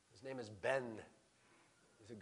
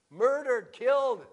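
raised, much louder single words; loud emphasis; 7 semitones higher, 13 dB louder, slower than usual. Real, not acted. Preacher telling congregation of a massacre, loud emphasis. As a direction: hit each word hard, higher and louder.

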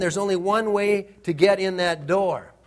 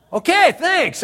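eager thanks; 11 semitones higher, 7 dB louder than usual. Retold or imitated. Eager reply to guard's tip.

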